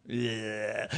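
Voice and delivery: very low, soft creepy drawl; grandpa's low yeah. Retold or imitated. Grandfather showing fallen-out teeth, low and eerie. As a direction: let it sink low and eerie. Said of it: "he just go".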